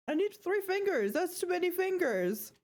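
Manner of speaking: high squeaky mimicry of a flustered rant; exaggerated high whine, imitating; mock high-pitched complaint. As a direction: mimic a funny clip in a high voice. Mimics the funny clip just played.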